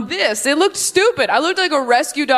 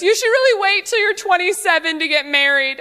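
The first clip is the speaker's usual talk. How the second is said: mock audience woman; 8 semitones higher than usual. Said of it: "yell at me from the audience".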